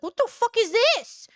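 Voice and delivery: high, hammed-up mock shock; mock shocked Ethan. Streamer mocks Ethan's shock, high hammed-up voice. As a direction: play him stunned by his own words. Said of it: "he's like".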